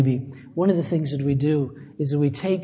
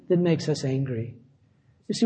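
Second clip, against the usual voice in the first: ordinary talk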